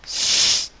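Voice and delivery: S as a loud, long hiss; S held as pure sibilant, not the letter name; isolated first sound. Shown as a demonstration. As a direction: hiss the bare S with no vowel. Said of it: "premier son".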